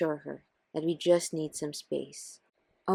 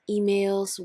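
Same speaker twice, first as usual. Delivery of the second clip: ordinary delivery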